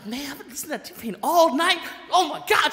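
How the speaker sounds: high, loud gushing mock-wife voice; imitating excited wife. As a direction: mimic your wife raving over music. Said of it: "my wife, she was driving, she's like".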